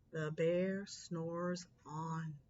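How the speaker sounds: slow, low, drawn-out refrain. A narrator's reading. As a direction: lull it out slowly.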